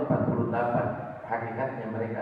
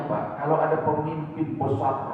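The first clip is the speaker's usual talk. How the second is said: normal talking voice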